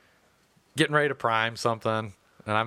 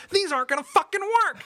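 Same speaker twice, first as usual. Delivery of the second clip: very high, hilarious squealed insult; mock high-pitched yell; 3 dB louder than usual. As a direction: imitate a furious man's voice shooting way up. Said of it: "it got, like, high as shit".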